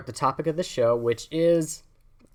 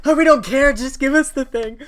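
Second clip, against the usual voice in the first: high, loud mock heckle; impatient crowd; 12 semitones higher, 9 dB louder than usual. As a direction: heckle like a crowd tired of waiting. Voicing the impatient waiting crowd.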